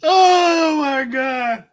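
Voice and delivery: slow, high, drawn-out gasp of disbelief; laughing disbelief. Real, not acted. Streamer reacting to a chat joke, slow high disbelief. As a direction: stretch it out high, still laughing.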